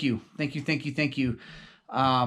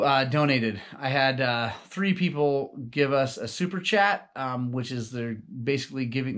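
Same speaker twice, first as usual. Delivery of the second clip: usual speaking voice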